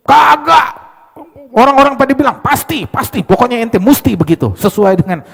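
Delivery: high, loud indignant yell; shouted denial. Retold or imitated. Stubborn accuser yelling he must be guilty, loud and high. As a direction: shout him down.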